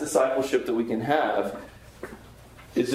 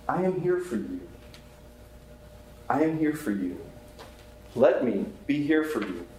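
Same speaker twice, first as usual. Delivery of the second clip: reassuring; 5 dB quieter than usual. Retold or imitated. Softly comforting a grieving person.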